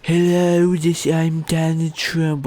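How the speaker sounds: bad Trump impression. Shown as a demonstration. Mocking a rapper's inaccurate Trump, high slow caricature. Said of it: "doesn't actually sound like that".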